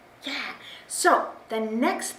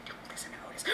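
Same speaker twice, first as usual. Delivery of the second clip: much softer, unvoiced whisper; stage whisper; 23 dB quieter, much faster than usual. Librarian whispers to the children behind the puppet's back, soft whisper. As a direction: let the kids in on a secret.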